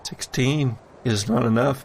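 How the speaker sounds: ordinary talk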